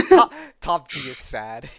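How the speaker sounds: words broken by real laughter; laughing. Real, not acted. Host joking to viewers about a depressed character, laughing. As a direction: crack up while getting it out.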